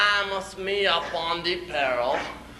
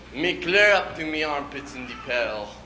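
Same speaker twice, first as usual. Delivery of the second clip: usual speaking voice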